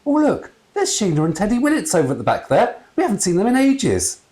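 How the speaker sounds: gushing excited mum; excited ghost mother. A narrator's reading. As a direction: spot an old friend with glee. Mother excitedly spots friend.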